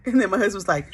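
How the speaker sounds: ordinary talk, nothing marked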